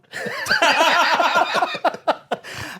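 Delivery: very high, loud burst of laughter; huge laugh. Real, not acted. Laugh at the punchline.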